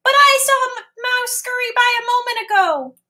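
very high tiny fly. Acted. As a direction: squeak it like a little fly. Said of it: "says Fly".

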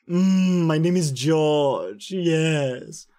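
mocking British accent; put-on British voice, drawn-out and self-satisfied; George's mock British. Shown as a demonstration. George mocking British people, exaggerated British accent. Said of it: "George's mocking British people voice".

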